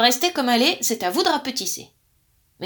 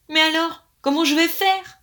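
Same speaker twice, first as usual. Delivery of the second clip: higher, louder desperate plea; 6 semitones higher, 5 dB louder than usual. A narrator's reading. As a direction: cry out helplessly. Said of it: "d'un ton désespéré".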